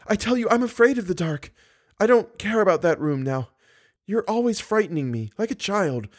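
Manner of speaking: put-on high-pitched frightened young woman; anguished. A narrator's reading. Girl begs monster not to darken room, anguished. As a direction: plead fearfully in a high voice. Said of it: "Christine's voice of anguish".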